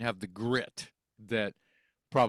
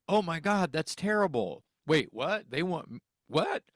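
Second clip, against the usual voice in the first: stunned disbelief; 6 semitones higher than usual. Retold or imitated. Hearing the singer is sick.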